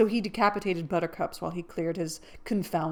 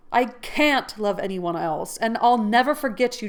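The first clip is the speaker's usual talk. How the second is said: passionate vow; 4 semitones higher, 8 dB louder than usual. Acted. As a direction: swear it with all your heart.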